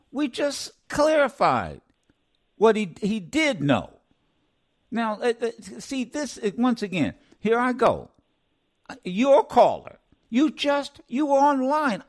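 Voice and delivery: host losing patience with a caller, building anger; angry. Real, not acted. Radio host scolding a caller, rising anger. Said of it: "now I am being rude".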